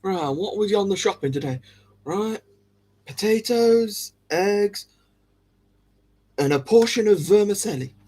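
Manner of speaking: imagined shopper. Acted. Acts out reading a shopping list.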